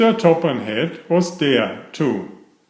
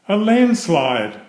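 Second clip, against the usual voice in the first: startled discovery; 7 semitones higher than usual. Acted. Driver discovers the landslide.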